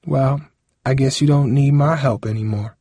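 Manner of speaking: understated, sighing reproach; resigned sigh. A narrator's reading. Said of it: "she sighed".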